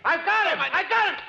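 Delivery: high, loud calls for help; shouting. Acted. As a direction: yell for Crane while holding him down. Grappling with attacker in dark.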